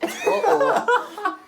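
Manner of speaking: very high squeaky uh-oh; [name] seeing the Shaggy picture, squeaky; mock uh-oh. [name] reacting to Shaggy pic.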